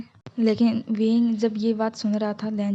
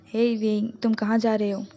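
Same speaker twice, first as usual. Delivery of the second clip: usual speaking voice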